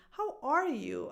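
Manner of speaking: ARE pitched high, falling on "you"; stress on ARE. Shown as a demonstration. Greeting someone not seen in a while, ARE stressed. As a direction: lift "are", drop "you". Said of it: "I meet you and I ask you".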